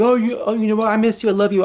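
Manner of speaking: a lightly voiced, hammed-up lovesick boyfriend; mocking the ex. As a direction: parody his fake loving pleas. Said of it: "All this kind of bullshit".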